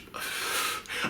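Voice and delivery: long unvoiced sigh; heavy sigh. Real, not acted. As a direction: let out a shaky breath. Emotional thank-you, lost for words.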